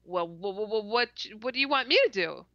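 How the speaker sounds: imitated flustered stammer; stuttering. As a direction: stumble on the first word, then rush the question out. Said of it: "and I'm stuttering again".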